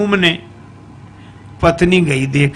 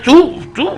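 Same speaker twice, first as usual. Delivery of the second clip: sharp, high squeaky noise through clenched teeth; mock teeth-gritting squeal; 10 semitones higher than usual. Wife glaring at her reflection, squeaky gritted-teeth noise. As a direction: squeak like someone baring their teeth.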